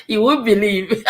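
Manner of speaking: loud words broken by laughter; laughing. Real, not acted. Speaker confessing her yelling to viewers, laughing. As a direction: talk through a real laugh.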